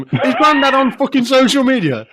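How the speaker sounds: mock the idea with loud disbelief; incredulous shout. Real, not acted. Mocking grief posts incredulously.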